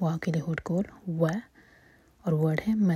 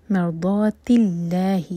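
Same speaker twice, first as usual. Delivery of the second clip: slow recitation; 5 dB louder, slower than usual. Shown as a demonstration. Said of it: "ضاد heavy".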